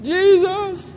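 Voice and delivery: high, loud, drawn-out mock scream; playful cry. Shown as a demonstration. Preacher showing congregation the fun, high holler. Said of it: "if you ever feel like it, having some fun".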